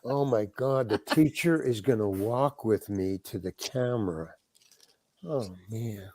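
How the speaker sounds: low, soft undertone sinking away; quiet private dismay. Retold or imitated. Actor about to be walked to camera like a pupil, quiet dismay. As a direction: think aloud in subdued dread.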